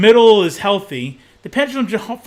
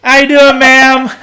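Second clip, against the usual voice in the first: loud, high, drawn-out holler; calling out; 6 semitones higher, 11 dB louder, much slower than usual. Pretend. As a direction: shout a playful greeting across the house. Shouts greeting to co-host's mother.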